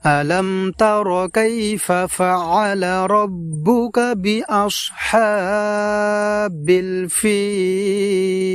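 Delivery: melodic recitation, last word held long; Quran recitation. Shown as a demonstration. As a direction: chant the verse, stretching الفيل. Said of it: "এইভাবে শুদ্ধ উচ্চারণে পড়ব". (সুর করে তিলাওয়াত, শেষে টান)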